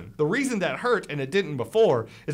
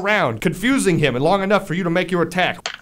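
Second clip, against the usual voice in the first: higher, animated combat narration; lively blow-by-blow storytelling; game master's action narration; 3 semitones higher, 3 dB louder than usual. Acted. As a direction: narrate the strike with punch and relish.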